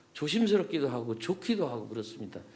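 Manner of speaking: normal talking voice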